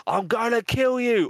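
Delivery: high-pitched mock threat; hammed-up death threat in casual wording; Peter. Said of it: "Peter was saying".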